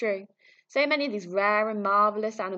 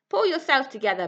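higher, louder snarl; 7 semitones higher, 8 dB louder than usual. Acted. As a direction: scold him with contempt. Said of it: "snarled Bakawackley".

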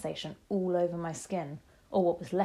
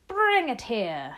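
loud, high, slow imperious shout; shouted command; 4 semitones higher, 6 dB louder, slower than usual. A narrator's reading. Grand High Witch summoning her captive, shouted command. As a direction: draw out the order as you shout. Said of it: "shouted the Grand High Witch".

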